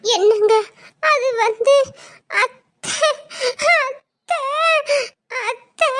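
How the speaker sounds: halting, sobbed single words; tearful, breaking off repeatedly; crying. Acted. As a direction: choke out each word between sobs. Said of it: "ஏன் அழுறா?".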